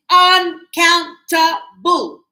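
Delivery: "uncountable" slow and loud, syllable by syllable; syllable-by-syllable emphasis. Shown as a demonstration. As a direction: break "uncountable" into loud, separate beats. Teacher drills the grammar term.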